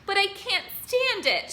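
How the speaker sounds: high, loud shout. A narrator's reading. Wilbur refusing to leave Charlotte, high loud shout. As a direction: cry it out in despair. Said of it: "shouted Wilbur".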